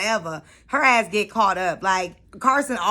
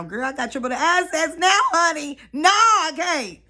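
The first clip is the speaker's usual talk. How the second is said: sassy disbelief; 7 semitones higher, 3 dB louder than usual. Real, not acted. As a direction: throw shade at the screen, no way. Sassy reaction to the show.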